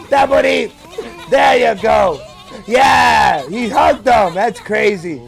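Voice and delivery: normal talking voice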